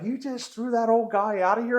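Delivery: in disbelief at the ref; shocked onlookers. Retold or imitated. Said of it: "the people are like".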